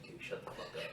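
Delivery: whispered dismissive retort; hushed retort. Retold or imitated. Veteran shutting down boastful cousin, whispered scoff. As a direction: hiss it low at your friend. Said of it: "I was like".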